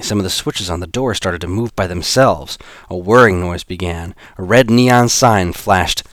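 plain reading voice

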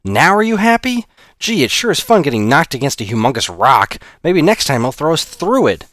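high, loud sarcastic jab. Acted. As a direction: needle him through the pain. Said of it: "Wincing with pain".

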